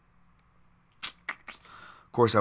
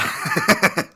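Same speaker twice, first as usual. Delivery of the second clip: laugh; 10 semitones higher, 8 dB louder than usual. Real, not acted. Laughs at his own joke.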